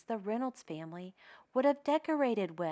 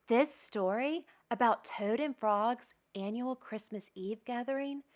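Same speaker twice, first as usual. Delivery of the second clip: plain reading voice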